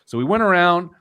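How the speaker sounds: ordinary delivery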